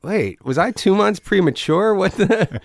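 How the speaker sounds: high-pitched puzzled realization; dawning disbelief. Retold or imitated. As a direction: replay the moment it clicks, baffled. Acting out his sudden realization.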